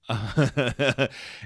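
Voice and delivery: a big burst of laughter; loud laugh. Real, not acted. Laughing at himself while telling the story, a loud burst of laughter.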